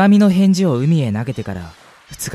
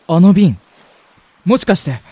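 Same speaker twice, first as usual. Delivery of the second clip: sudden, louder and higher recognition; surprised; 6 semitones higher, 6 dB louder than usual. Acted. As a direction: catch your breath as you spot it. Spots the bottle on the beach.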